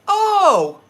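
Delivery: high, loud, slow mocking outsider voice. People who learn he's from New England, high loud mock voice. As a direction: squeal it like a surprised know-it-all. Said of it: "they're like".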